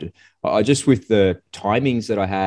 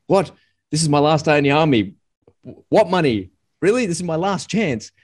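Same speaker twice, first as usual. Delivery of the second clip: higher, incredulous; surprised self; 9 semitones higher than usual. Retold or imitated. A departing soldier puzzled at the question, higher disbelief. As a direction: replay your own puzzled disbelief. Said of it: "I was like".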